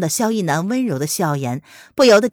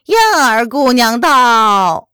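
high, slow drawn-out court announcement; shrill eunuch call; 4 semitones higher, 7 dB louder, much slower than usual. Acted. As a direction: stretch it out like a palace eunuch. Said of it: "一声尖锐的公鸭嗓". (尖锐的公鸭嗓通报)

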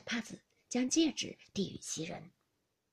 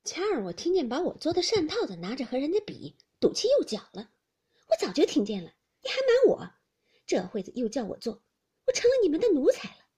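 louder, higher, sarcastic sneer, fast at the end; mild mock indignation, louder and higher; cold sneer; 7 semitones higher, 8 dB louder than usual. A narrator's reading. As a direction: let the resentment bite as you sneer. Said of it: "史湘云冷笑道".

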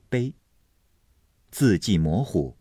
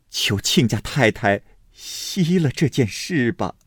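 higher, pressing appeal, loudest on the self-blame; reluctant pleading; 7 semitones higher, 3 dB louder than usual. A narrator's reading. Mother begs Aunt Xue to drop the case, pleading. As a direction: beg them to let it drop. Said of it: "不得已反求薛姨妈道".

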